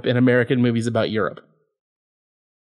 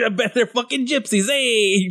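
put-on higher-pitched joke Italian accent; mock Italian; 7 semitones higher than usual. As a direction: send up an indignant Italian, pitched up. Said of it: "Italian Anti-Defamation League is gonna be all".

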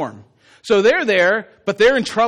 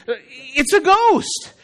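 very drawn-out, raised cry; mock frightened cry; 4 semitones higher, much slower than usual. A narrator's reading. Disciples mistaking Jesus for a ghost, crying out. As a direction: stretch out the hammed-up alarm. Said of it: "they cried out".

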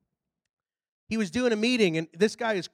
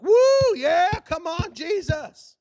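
high whoop fading into praise; cheering crowd; 8 semitones higher, 4 dB louder, slower than usual. Retold or imitated. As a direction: imitate a church crowd whooping. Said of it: "what you're doing right now".

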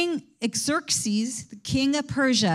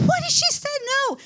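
a king's outraged cry, much higher, near squeaking; angry king; 13 semitones higher than usual. Retold or imitated. A king told his queen refused him, indignant and high. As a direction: play the stunned fury of a refused king. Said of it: "he was so angry".

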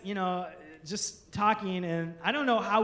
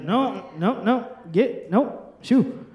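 loud, repeated mock refusals; emphatic refusal; 7 dB louder, slower than usual. Acting out waving away the vasectomy idea, emphatic refusal. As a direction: shoo them off loudly.

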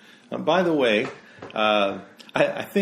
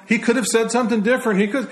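mocking the press; 12 semitones higher, 5 dB louder, faster than usual. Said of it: "They just said".

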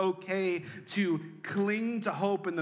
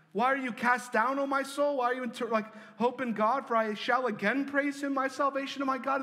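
rushed retelling; 10 semitones higher, 4 dB louder than usual. Retold or imitated. Rushed re-reading showing abrupt ending.

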